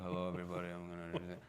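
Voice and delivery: low, flat, dull and unsure; bored nervous kid. Retold or imitated. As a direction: mumble it like a shy bored kid. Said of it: "I sound like the most bored kid that's, like, nervous".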